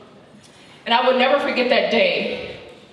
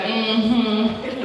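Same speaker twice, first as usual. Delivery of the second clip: dismissive mm-hmm. Retold or imitated. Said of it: "they just be like".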